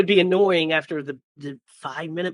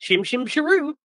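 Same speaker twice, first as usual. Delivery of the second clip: high, sing-song hammed-up Cockney; mock Cockney accent; 10 semitones higher than usual. Riffing on British accents with cohosts, high mock Cockney. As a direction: toss off a cheery Cockney chim chiminey. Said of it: "Cockney accent".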